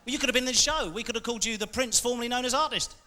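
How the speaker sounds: ordinary delivery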